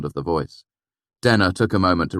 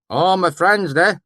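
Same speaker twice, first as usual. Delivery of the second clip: put-on valley accent; 13 semitones higher, 6 dB louder, slower than usual. A narrator's reading. Swineherd greeting the narrator, pleased, thick valley accent.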